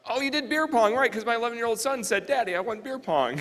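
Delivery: excited. Real, not acted. Excited story about son.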